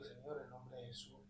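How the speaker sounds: soft, low-volume exorcising prayer; murmured rebuke. Retold or imitated. Passenger casting out spirits in Jesus' name, murmured. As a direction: rebuke the spirit under your breath. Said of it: "empiezo a, a reprender".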